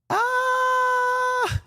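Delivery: shriek. Real, not acted. Sudden outburst mid-stream.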